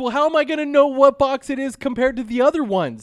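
high, loud exasperated yelling; exasperated shout. Acted. Co-host acting out the Cincinnati box argument, exasperated shout. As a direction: shout it like you're fed up with them.

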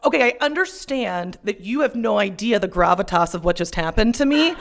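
normal talking voice

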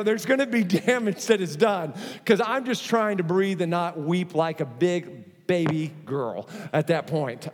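usual speaking voice

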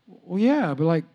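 low and halting, then a quick mumbled question; stumble, then rush out a doubtful question; hesitant, confused. Retold or imitated. Pastor asking if his friend will pray now, sheepish. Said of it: "I said".

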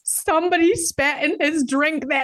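thrilled, almost squealing as she tells it; giddy delight in the revenge; excited. Real, not acted. Laughing disbelief retelling.

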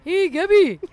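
high, loud mocking mimic of a friend; hammed-up high call to Gabby; imitating Megan. Mocking Megan's call to Gabby, high and loud. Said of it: "That's how you sound, Megan".

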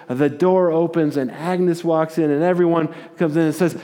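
ordinary talk

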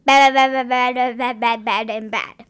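loud, hammered-out repeated bads; chanting bad over and over, dropping off at the end; mock rat prophet. Reading rat's repeated prediction.

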